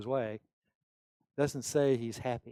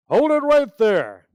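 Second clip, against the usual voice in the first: much higher, much louder cutting-off shout; loud outburst; 9 semitones higher, 16 dB louder than usual. Retold or imitated. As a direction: bark it to stop him cold. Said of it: "he did so with a loud voice".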